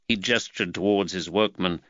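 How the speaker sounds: usual speaking voice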